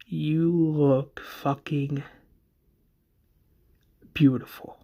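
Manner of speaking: slow, drawn-out admiration; awed compliment. Acted. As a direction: let each word linger in mild awe. Acted awed compliment to lover.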